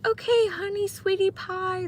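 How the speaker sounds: high, syrupy mock-loving voice; mock lovey-dovey cooing; sugary sweet talk. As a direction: coo it like an overly sweet couple. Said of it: "if we were always like".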